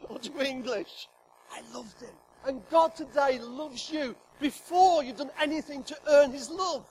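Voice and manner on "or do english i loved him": whisper. Real, not acted. Laughing aside, drops to whisper.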